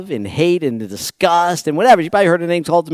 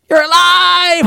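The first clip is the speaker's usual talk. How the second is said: high, loud gleeful exclamation; elated loud shout; overjoyed cry; 7 dB louder, slower than usual. Acted. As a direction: cry out in disbelieving joy. Mary hugging risen Jesus.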